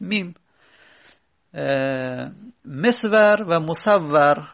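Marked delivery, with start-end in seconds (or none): 2.6-4.5 مصور as mesvar, then مصور as musawwar, slowly; contrasting musawwar pair. Shown as a demonstration. Teacher shows a look-alike word pair, slowly.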